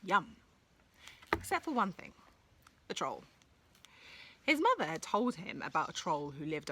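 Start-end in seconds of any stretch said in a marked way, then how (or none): none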